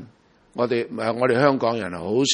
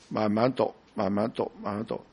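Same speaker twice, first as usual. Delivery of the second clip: softer and lower, fading away with each repeat; slow careful reading; 4 semitones lower, 8 dB quieter than usual. Shown as a demonstration. Preacher showing how he starts a new book, softly fading. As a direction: repeat it ever more softly, as if settling into a book. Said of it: "好慢，真係".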